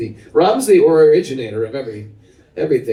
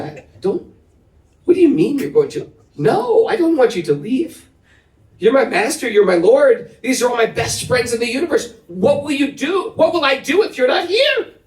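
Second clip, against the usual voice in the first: pleading disciple; 11 semitones higher than usual. Retold or imitated. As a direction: beg the master not to go. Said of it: "she was very sad".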